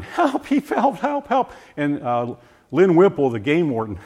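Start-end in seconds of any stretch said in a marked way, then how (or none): 0.0-1.5 much higher, desperate retold plea; crying for help. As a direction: beg for help through tears. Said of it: "John starts crying".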